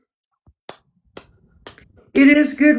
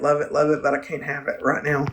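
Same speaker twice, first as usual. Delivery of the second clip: ordinary talk, nothing marked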